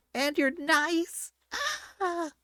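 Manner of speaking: high, slow, crying disbelief; hammed-up shock at a nice neighbor; mock astonished cry. Anna's shocked reaction retold.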